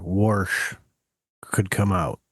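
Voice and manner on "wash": "warsh", wash with an R put in; dialect added R. Shown as a demonstration. Friend explaining Irish dialects, wash with R. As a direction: say "warsh" with R before the sh. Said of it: "certain Irish dialects where warsh".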